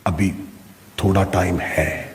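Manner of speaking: low, soft stalling; hushed, low voice of temptation; tempting inner voice. Acted. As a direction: quietly put off getting up. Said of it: "دوسری آواز آئی".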